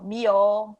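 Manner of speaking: melhor dito mió; caipira accent. Shown as a demonstration. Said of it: "Ou melhor". (melhor no sotaque caipira, mió)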